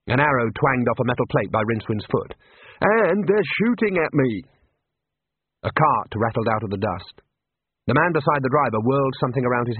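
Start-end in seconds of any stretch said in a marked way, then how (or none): 2.8-4.4 higher, slow alarmed whine; a nervous wizard complaining under fire; panicky wizard. Acted. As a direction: protest in rising panic. Rincewind panics.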